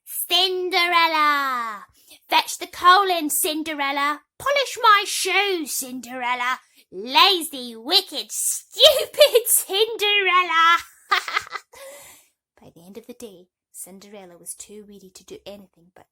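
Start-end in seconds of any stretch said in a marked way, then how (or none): 0.0-10.8 high, loud, bossy stepsister shouts; shrill-voiced sisters screaming for Cinderella; shrieking stepsisters. A narrator's reading. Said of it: "her stepsisters called her". 10.8-12.2 high, loud mocking cackle; cackling stepsister laugh. A narrator's reading.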